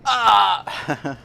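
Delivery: high mock cry of panic; hammed-up yelp of fear; retold scream. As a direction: shriek like a kid dropped in a lake. Thrown in the lake.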